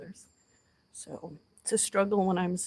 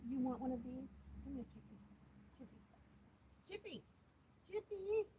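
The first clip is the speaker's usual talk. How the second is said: high, hushed pet talk; soft cooing to a chipmunk; 5 semitones higher, 18 dB quieter than usual. Real, not acted. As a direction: coax the little chipmunk gently, barely above a breath.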